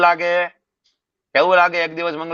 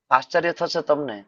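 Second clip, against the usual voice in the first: ordinary talk, nothing marked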